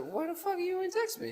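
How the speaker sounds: half-hearted high-pitched imitated yell; mock yell. Friend retelling how his cousin yelled at him, mock high yell. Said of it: "You came back and yelled at me".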